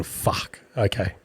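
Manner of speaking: whispered curse, breath only; panicked whisper. Retold or imitated. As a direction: hush it out in a panic. Panicked whisper hearing footsteps.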